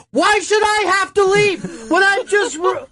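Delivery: very high mock-indignant yell; retold yelling. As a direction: imitate someone protesting at the top of their register. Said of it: "he's fucking yelling".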